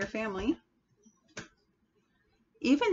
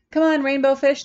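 high, loud playful call; 7 semitones higher, 9 dB louder than usual. Acted. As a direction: call across the water to a friend. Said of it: "they would call".

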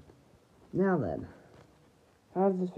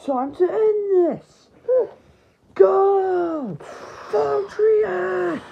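high, loud, drawn-out attack call; battle shout; 8 semitones higher, 5 dB louder, much slower than usual. Acted. Player calls Valtryek to attack, loud battle shout. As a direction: shout the finishing move.

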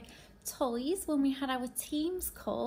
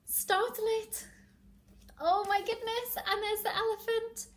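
higher, dismayed storyteller voice; animated story reading; 8 semitones higher than usual. Acted. Teacher seeing the elephant all upset again, animated mock dismay. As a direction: react with playful dismay for little listeners. Reads picture book to children.

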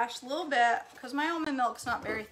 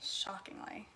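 whispered aside, very soft; whispered mock confession; 12 dB quieter than usual. Real, not acted. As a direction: drop to a breathy confidential whisper.

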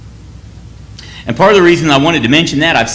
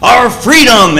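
Wallace rallying troops; 4 semitones higher, slower than usual. Retold or imitated. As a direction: stir the deserters to fight. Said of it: "William Wallace says".

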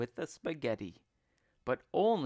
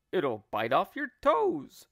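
raised, loud teasing threat; scaremongering; 4 semitones higher, 6 dB louder than usual. Acted. Husband frightens wife about her toes, scaremongering. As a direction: warn her loudly to frighten her.

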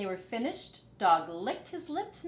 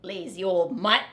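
grumbling insult; 10 dB louder than usual. Acted. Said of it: "said Rat".

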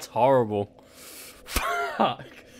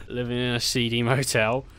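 ordinary delivery

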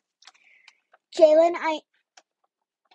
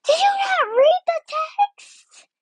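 very high, loud, drawn-out mock shriek; exasperated outburst; 6 dB louder than usual. Answering a friend who asks for forgiveness, exasperated. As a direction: squeal it loudly, stretching the words out.

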